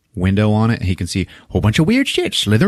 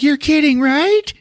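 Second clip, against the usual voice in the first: very high, disbelieving put-on voice; imitated girlfriend. Girlfriend left alone in the car, mock incredulous. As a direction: squeal the question in mock disbelief.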